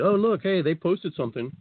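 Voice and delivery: high, sing-song teasing friend; mock sarcastic. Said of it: "trying to be sarcastic and funny".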